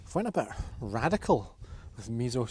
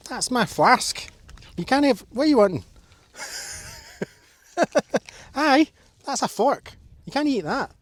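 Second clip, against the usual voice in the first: high, loud fussing over dogs; 9 semitones higher, 8 dB louder than usual. Real, not acted. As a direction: coo and scold the pups playfully. Dogs crowding round her flask.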